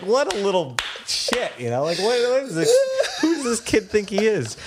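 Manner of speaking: high, fast, indignant grumbling; annoyed sound guy. Retold or imitated. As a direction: scoff like an irritated tech.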